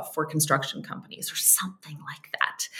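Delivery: half-whispered throwaway detail; whispered aside. Real, not acted. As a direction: drop it to a near whisper. Quiet aside about details.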